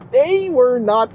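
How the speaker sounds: laughing. Real, not acted. Delighted at the car.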